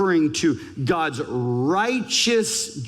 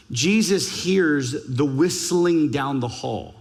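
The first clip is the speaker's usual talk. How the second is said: ordinary delivery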